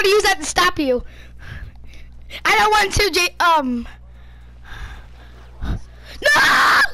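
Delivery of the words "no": sharp high yell; panicked no. Real, not acted. Kid cornered in game, alarmed yell. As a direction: cry out in alarm.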